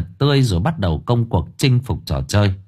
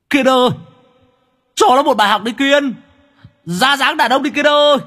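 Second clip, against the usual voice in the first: shouted cheering. Acted. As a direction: yell encouragement from the sidelines. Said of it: "hét lên". (hét cổ vũ giọng cao the thé)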